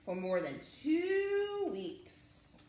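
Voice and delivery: put-on reading a picture book to kids; lively read-aloud with rising phrases; storyteller voice for children; story narration.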